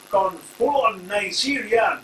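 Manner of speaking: hammed-up Nigerian accent, stretched out; bad Nigerian accent. Reviewer mocking wrestler's new gimmick, in a bad Nigerian accent. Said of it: "sorry for my, uh, bad accent there".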